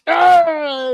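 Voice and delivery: loud, high imitated wrestler's yell; high-pitched drawn-out "aah"; mock battle cry. Imitating wrestlers roaring in turn.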